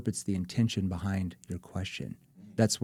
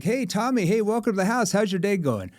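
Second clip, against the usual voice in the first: much higher friendly greeting; high bright homeowner welcome; prospect greeting; 10 semitones higher, 3 dB louder than usual. Retold or imitated. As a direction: play the cheery homeowner. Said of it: "if a prospect says".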